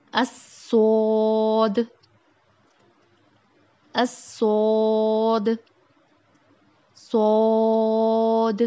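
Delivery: صاد starting with a hiss, then thick and full-mouthed, said loud and slow; hiss, then fill the mouth and land the final د; heavy, drawn-out swad. Shown as a demonstration. Teacher models the heavy Arabic صاد loudly and slowly. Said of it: "موٹا اور بھر کے پڑھیں گے".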